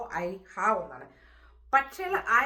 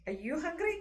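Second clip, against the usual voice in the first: "Are you hungry?" climbing high to the end; question intonation; 8 semitones higher, much slower than usual. Shown as a demonstration. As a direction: ask "Are you hungry?" with a clear rising tune. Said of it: "ഒരു question മാർക്കിൻ്റെ ഒരു രൂപത്തിൽ".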